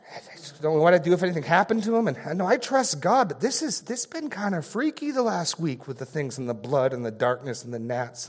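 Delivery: high, fast, jittery worried father; anxious rambling. Acted. Father afraid for his son on Passover night, high, fast, anxious. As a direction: babble your fears, pitch climbing. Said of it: "The first says".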